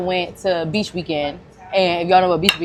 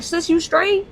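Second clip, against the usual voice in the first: much higher, caring check-in; higher concerned voice to a stranger in trouble; 9 semitones higher than usual. Retold or imitated. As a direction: reach out to a woman in trouble. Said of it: "So I'm like".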